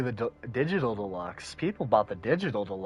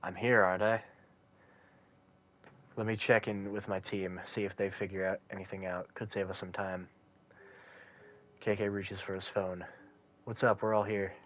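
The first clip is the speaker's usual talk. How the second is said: low, soft, calm detective; 5 semitones lower, 7 dB quieter than usual. Acted. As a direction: speak low and quiet like a detective. Reads detective lines and narration.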